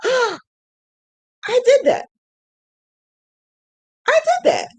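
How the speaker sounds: high, slow, amazed; proud squeal. Real, not acted. Admiring her finished drawing, proud squeal. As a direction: marvel at your own work.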